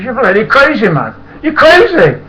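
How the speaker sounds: very high, loud shouting; staggering passenger yells at the drunk driver; drunk's yell. Retold or imitated. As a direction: holler it at the driver.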